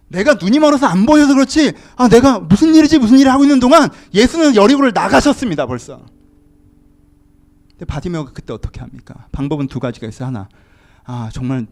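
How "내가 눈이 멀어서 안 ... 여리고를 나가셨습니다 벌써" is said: loud, high, urgent preaching voice; forceful preaching. Real, not acted. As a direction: preach it out with raised voice. (힘주어 외치는 설교)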